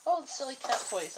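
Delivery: sing-song high voice for silly toys; high pet-talk. Real, not acted. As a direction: coo it up high like talking to a pet. Spots cat toys, to herself.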